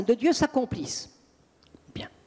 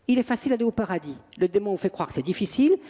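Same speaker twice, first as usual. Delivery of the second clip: ordinary delivery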